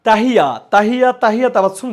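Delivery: excited call-out. Real, not acted. Teacher hails the student who answered right, excited call-out. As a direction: call the name out loud, delighted.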